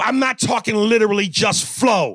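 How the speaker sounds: real heated protest; exasperated. Real, not acted. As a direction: snap back in real frustration. Heated argument with co-host.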